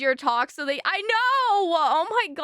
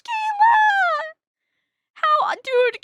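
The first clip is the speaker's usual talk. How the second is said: excited shout; 12 semitones higher, 8 dB louder, slower than usual. Real, not acted. Excited thanks to a gifter.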